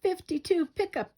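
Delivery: high, loud gleeful scream. A narrator's reading. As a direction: shriek it as a prank. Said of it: "they screamed".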